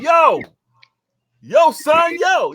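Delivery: loud, high holler across the street; imitated yelling. Imitating New York women calling at you, loud yell. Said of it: "the women will yell at you like".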